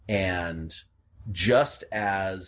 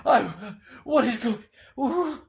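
high, frantic disoriented cries; terrified and lost, voice up high; waking in panic; panicked confusion; 3 dB louder than usual. Acted. Revived man panics, confused.

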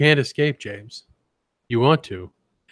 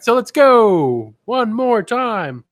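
drawn-out call; 9 semitones higher, 4 dB louder, much slower than usual. Acted. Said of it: "slowed mock announcement".

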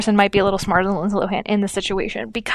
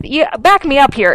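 usual speaking voice

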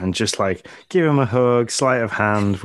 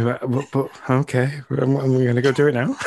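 put-on groggy kid stammer; 4 semitones higher than usual. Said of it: "you kind of spend that whole time going".

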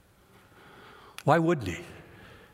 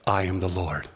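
tender voice of God; 4 semitones lower than usual. Acted. God reassuring his enslaved people, lower and tender. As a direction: speak it as a tender promise.

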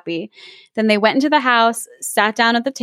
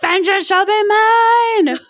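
mock vengeful; 10 semitones higher, slower than usual. Hams up the bird's revenge.

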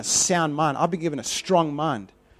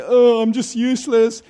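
mock despair; 4 semitones higher, 6 dB louder than usual. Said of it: "And I was like".

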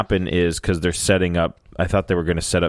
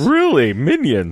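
incredulous; 7 semitones higher than usual. Retold or imitated. Mocking reply about Minions.

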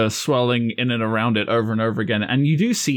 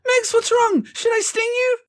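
very high squeaky voice; worried little creature. Acted. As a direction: squeak with concern for a friend. Small creature questions confused companion.

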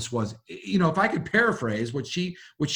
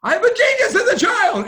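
much higher, louder burst of delight; excited exclamation; 8 dB louder than usual. Retold or imitated. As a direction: shout it out, thrilled. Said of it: "Oh my God".

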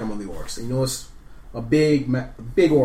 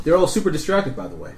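normal talking voice

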